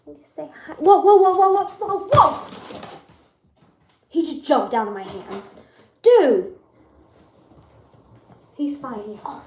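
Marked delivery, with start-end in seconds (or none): 0.7-2.5 loud, high burst of alarm; real startled shout. Real, not acted. Rabbit jumps out of her hands. 6.0-6.6 one loud, shocked burst; startled exclamation. Real, not acted. Scolding rabbit that just jumped.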